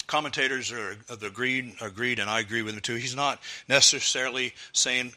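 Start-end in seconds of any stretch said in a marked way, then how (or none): none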